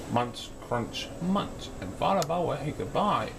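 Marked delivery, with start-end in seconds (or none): none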